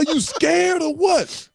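high, slow mocking challenge; taunting challenge. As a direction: goad the teammate. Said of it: "I said".

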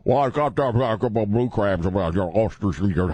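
put-on mumbled Eastern Shore Maryland Waterman accent, words run together; hammed-up Waterman accent from Maryland's Eastern Shore; thick Waterman accent. Maryland crabber selling blue crabs and oysters, thick Waterman accent. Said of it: "such thick Waterman accents".

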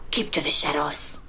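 hushed sneaky ninja voice; low secretive whisper. Pretend. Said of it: "is that your ninja voice".

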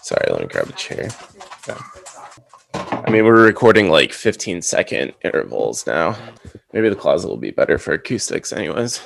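muffled closet sound, crammed in tight; talking from inside a small closet among clothes; recording in a closet. Real, not acted. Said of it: "I'm going in the closet".

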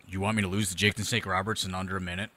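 a hint of a wounded meathead; low offended bro. Matt Morgan refusing to lose, a low indignant bro. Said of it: "and he goes".

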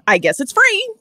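high, jokey singsong. Acted. Customer jokes to the cashier, high and corny. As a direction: pitch it up like a corny quip. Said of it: "to say to the cashier".

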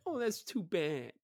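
high, drawn-out mock sympathy. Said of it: "mock me like that".

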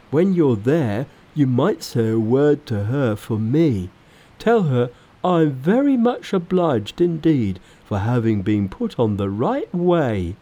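lightly voiced pig disciple; Pigsy, asking a favour. A narrator's reading. Pig disciple sending thanks to the Bodhisattva, lightly characterised. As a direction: lay on a touch of pig character.

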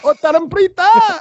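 high-pitched, hammed-up cry of a name; mock shout. Co-host mimics his friend yelling her name, mock shout. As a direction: call the name out high, rising into a yell. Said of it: "end up shouting it like that".

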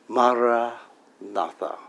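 Mara spoken softly on an inhale; on the in-breath. Shown as a demonstration. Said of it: "I breathe in the first two syllables".

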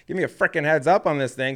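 worked-up complaint with a laugh; exasperated rant. Retold or imitated. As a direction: blow off steam at the agent. Venting at agent over fine.

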